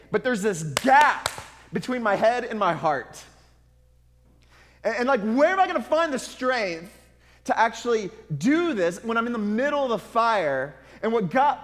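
fervent sermon climax; impassioned preaching. Real, not acted. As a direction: plead with the congregation at full force. Preaching at full force.

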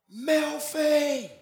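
calling a name across the room, high and strained; a held-back shout of a name; mock shout. Shown as a demonstration. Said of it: "I won't shout 'cause I'll upset you all".

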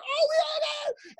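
high imitated crowd shout; crowd cheer. Comic imitates club crowd answering him, shouted. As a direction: call out like an excited audience.

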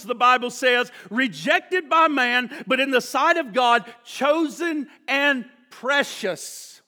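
high, emphatic sermon voice; full-force preaching. Real, not acted. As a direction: drive the scripture home, stretching key words.

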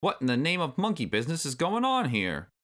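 put-on high, rough old granddad voice; Cranky Kong. A narrator's reading. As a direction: demand an answer in a rough old voice. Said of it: "came a rough voice".

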